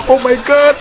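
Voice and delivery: high excited gasp; the crowd gasps in delight; excited guests. Pretend. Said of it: "everyone's like".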